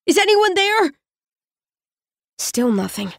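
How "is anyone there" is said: much higher, fearful shout; calling blindly into the dark; frightened call. Acted. Trapped, calling out.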